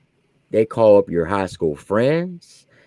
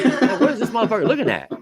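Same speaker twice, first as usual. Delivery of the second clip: laughing inner thought; 10 semitones higher, faster than usual. Retold or imitated. Inner thought at lazy eye.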